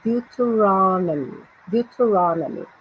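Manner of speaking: Deuteronomy slowed, with RON drawn out; stressed syllable. Shown as a demonstration. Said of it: "Eu até sublinhei aí a sílaba crônica".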